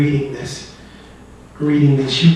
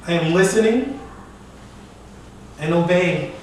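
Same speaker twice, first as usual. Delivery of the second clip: dramatic poetry recital; 3 semitones higher, 4 dB quieter than usual. Acted. Poet obeying the voice before leaping, dramatic recital. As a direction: answer the call in measured, raised verse.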